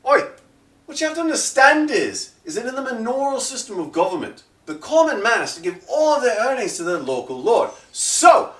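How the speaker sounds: high, fast, lecturing elf voice; a smug elf explaining feudal law; elf's lecture. Acted. The elf lectures the party on the manorial system, high and smug. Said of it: "And he".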